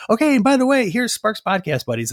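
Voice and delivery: high, loud, chirpy sign-off; sudden bright host. Retold or imitated. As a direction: snap into cheery host mode. Sudden cheery podcast host readout.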